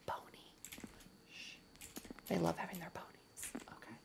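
hushed whisper so the pet won't hear. Real, not acted. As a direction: breathe it without voice. Hiding the B word from pet.

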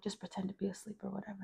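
quick secretive aside to a brother; whispering. Retold or imitated. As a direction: whisper it hurriedly under your breath. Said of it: "whispering to him".

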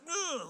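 high-pitched imitated groan; mock groan of a cornered friend; reluctant groan. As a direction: groan high, dodging attention.

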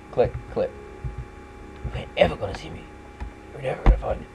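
whispered taunt. Acted. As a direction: whisper it sneakily.

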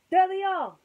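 high, loud spotting shout; excited cry. Acted. Woody spotting the soldiers, high loud cry. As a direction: call out as you spot them. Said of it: "cried Woody".

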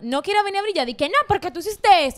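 acting out telling her off to her face; mock confrontation. Replaying what she would tell her friend, indignant scold.

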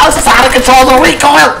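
frustrated shout. Real, not acted. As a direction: complain loudly at the gun. Frustrated at game.

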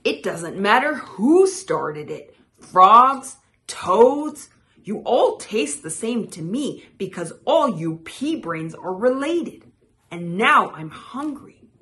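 loud, emphatic scolding; growling creature. A narrator's reading. Woken creature scolding frogs and toads, loud light growl. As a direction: loudly dress down the whole crowd. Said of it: "A low voice growled".

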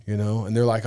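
usual speaking voice

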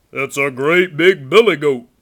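very loud, bold goat voice; big goat's reply. A narrator's reading. Great Big Billy answers the troll, booming voice. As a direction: boom back at full volume.

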